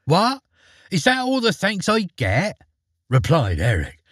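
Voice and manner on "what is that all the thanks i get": much higher, indignant mock outrage; affronted. A narrator's reading. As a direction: act offended at the ungrateful corpse. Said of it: "feeling most affronted".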